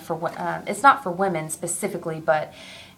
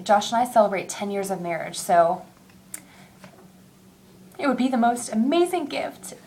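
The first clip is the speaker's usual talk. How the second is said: ordinary talk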